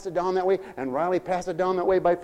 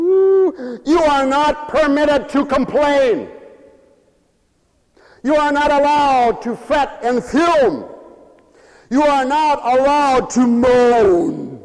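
slow, high, loud commands; full-force preaching; 9 semitones higher, 8 dB louder, slower than usual. Real, not acted. Preacher forbidding the congregation to complain, full-force preaching. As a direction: ring out each command, drawing out the key words.

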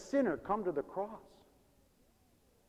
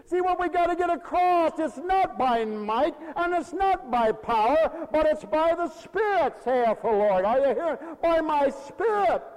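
high, loud, impassioned proclamation; full-force preaching; 14 semitones higher, 9 dB louder than usual. Real, not acted. Pastor tells congregation it's by the Spirit, full-force preaching. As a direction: drive it home at the top of the voice.

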